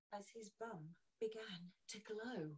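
hushed unvoiced whisper. Reader telling children the glowing reveal, whispered. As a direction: breathe the reveal like a secret.

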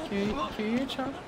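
soft, high call for a missing friend; playful pleading call. Pretend. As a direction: call the name hopefully in a small high voice. Pleading for missing game character.

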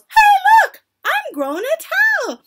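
put-on very high, loud child's cry; alarmed yell. A narrator's reading. Witch mimics girl discovering her tail, yelling. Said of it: "She yells".

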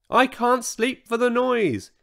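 high-pitched, complaining grumble; grumbling. A narrator's reading. Old Triceratops complains about the racket, grumbling. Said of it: "he grumbled".

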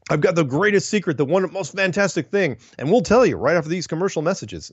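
high, hyped-up teaser voice, like a TV promo; mock cliffhanger teaser. Mocking emailers who tease a secret before breaking away. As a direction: tease the big secret, then cut to commercials. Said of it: "which is".

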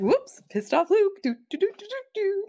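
high singsong mock nonchalance; breezily unbothered. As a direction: shrug it off and hum away in a high voice. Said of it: "she doesn't even care".

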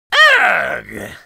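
loud disgusted witch groan; disgusted exclamation. Acted. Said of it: "she exclaimed".

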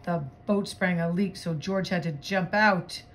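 animated picture-book voice; story narration. Acted. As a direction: read the chase loudly to children and leave them hanging. Reads picture book aloud.

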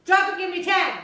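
loud, high drill-sergeant bark; barking gym coach. Pretend. Mock drill order.

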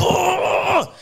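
very high, drawn-out wailing yell; mock enraged roar. Angry punter storming to the door, mock roaring. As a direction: imitate a customer bellowing in outrage. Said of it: "You shouting here".

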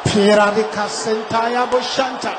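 rapid murmured tongues prayer; speaking in tongues. Acted. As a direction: pray in tongues under your breath. Preacher prays in tongues.

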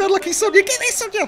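high-pitched frantic commentary; excitable gamer commentary; excited match call. Real, not acted. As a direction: urge the player on, voice shooting up. Urgent match call, chance missed.

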